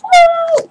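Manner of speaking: short high squeal of joy; tiny excited scream; delighted squeal. Real, not acted. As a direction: let out a happy shriek. Unboxing surprise.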